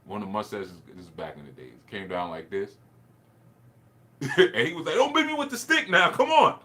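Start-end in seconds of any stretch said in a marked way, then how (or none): 4.9-6.6 very high, loud mock pleading; hammed-up cop yelling for mercy; panicked protest. Cop to a boy with a stick, panicked protest.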